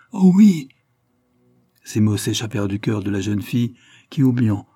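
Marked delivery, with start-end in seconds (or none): 0.1-0.7 high, loud burst of yes; joyful cry. A narrator's reading. Blanche answering Olivier's proposal, joyful outburst. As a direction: blurt it out from the heart. Blanche confesses her love.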